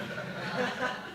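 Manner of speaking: breathy high laugh. Real, not acted. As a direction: burst out laughing. Laughs at own joke.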